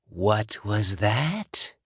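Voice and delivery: slow, breathy rasping whisper of a corpse; undead John. Acted. Dead ex-boyfriend luring Alice, slow rasping whisper. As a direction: hiss it through the door like a dead man.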